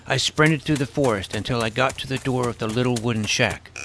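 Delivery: ordinary delivery, nothing marked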